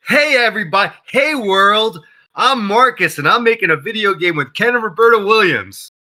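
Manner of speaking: high, bright announcing voice to the whole world; excited announcement. Retold or imitated. Announcing his game to the world on Twitter, mock excited. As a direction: shout it out to everyone online, high and eager. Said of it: "I'm like".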